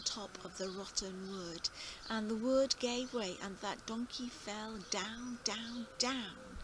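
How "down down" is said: ordinary delivery, nothing marked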